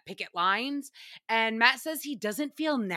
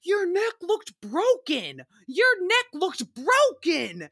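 put-on slow, higher worried drunk voice; drawn-out drunken worry; drunk Kyle; 7 semitones higher, slower than usual. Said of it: "And Kyle's like".

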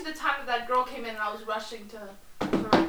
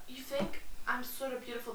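soft quote; 11 dB quieter than usual. Retold or imitated. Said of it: "quote read".

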